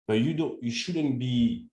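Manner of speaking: ordinary delivery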